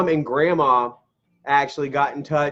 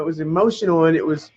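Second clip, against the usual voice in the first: ordinary delivery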